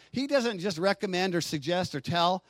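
normal talking voice

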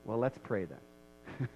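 half-hearted pious brush-off; mock dodge. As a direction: sheepishly dodge the hard talk. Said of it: "we just go".

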